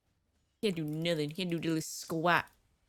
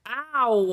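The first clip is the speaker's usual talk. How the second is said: mock pain yelp; 4 semitones higher, 6 dB louder than usual. Hit in game.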